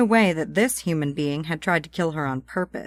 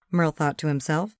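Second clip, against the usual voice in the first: ordinary delivery, nothing marked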